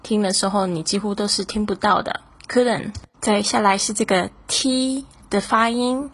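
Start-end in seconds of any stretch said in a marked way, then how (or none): 2.5-2.9 Couldn't with the T swallowed; Couldn't ending on the n, T dropped; silent final t. Shown as a demonstration. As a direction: say Couldn't with no audible T. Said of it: "后面本来是有一个T呢，你几乎都是听不到的".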